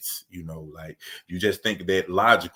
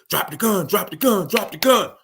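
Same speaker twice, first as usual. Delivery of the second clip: retold command, pitched higher and louder each time; mock police shout; 13 semitones higher, 6 dB louder than usual. Retelling police yelling at a surrendering teen, mounting mock shout. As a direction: bark it like the cops closing in, building up.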